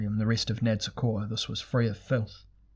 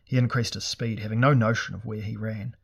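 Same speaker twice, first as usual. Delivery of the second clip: ordinary delivery, nothing marked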